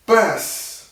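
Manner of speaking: high, loud "بس" that ends the fight; loud stop. Shown as a demonstration. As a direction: cut them off with a sharp high "بس". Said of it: "این کار کنید".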